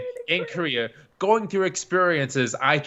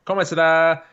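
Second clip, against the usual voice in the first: higher, louder quoted phrase; quoted thanks; 4 semitones higher, 6 dB louder than usual. Retold or imitated. Man repeating his Korean thank you, called out. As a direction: call out the foreign thanks. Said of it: "how to say thank you".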